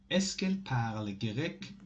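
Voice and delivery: ordinary talk, nothing marked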